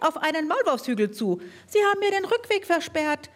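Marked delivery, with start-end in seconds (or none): 1.7-3.3 high squeaky voice rising in despair; desperate squeak. Acted. Trapped mole squeaking desperately that its way is blocked. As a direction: squeak it out in growing panic. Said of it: "piepste die Stimme mit zunehmender Verzweiflung".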